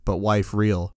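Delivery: ordinary delivery, nothing marked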